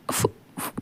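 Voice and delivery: breathless, voiceless stutter on the first sound; faint, frightened catch of breath; nervous stammer. Acted. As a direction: choke on the word from nerves. Nervous Wing stammering before confessing.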